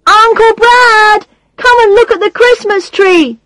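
high, loud excited call; child shouting. A narrator's reading. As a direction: call out eagerly like a little boy. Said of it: "shouts Christopher at the top of his voice".

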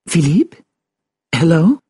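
high, questioning, anxious call; nervous. Acted. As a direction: ask uneasily into a silent line. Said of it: "she said nervously".

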